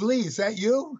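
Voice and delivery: surprised. Retold or imitated. Surprised recognition of old friend.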